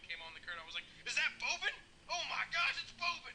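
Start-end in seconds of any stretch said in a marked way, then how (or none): 1.1-3.3 high-pitched, starstruck exclaiming; excited fan. Retold or imitated. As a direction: imitate a fan thrilled to spot a favorite player. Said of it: "so exciting".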